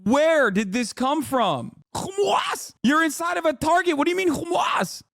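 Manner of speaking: high, mock-incredulous repeating of "Hamas" said "Humas"; mocking disbelief. Streamer to the clip, echoing "Hamas" as "Humas" in disbelief. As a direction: squeal "Hamas" back as "Humas", aghast.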